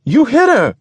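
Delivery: very high, loud accusing cry; shrieked exclamation. Acted. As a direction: exclaim it in horror at the driver. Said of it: "Sassafras exclaimed".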